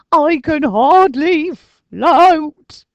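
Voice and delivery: high, loud, slow ghostly moan; moaning Arthur. A narrator's reading. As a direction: drag the complaint out. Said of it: "moaned Arthur".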